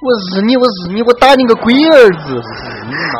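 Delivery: loud Sichuanese street swearing; mock cursing. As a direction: yell insults in Sichuan dialect. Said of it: "不是骂街啊".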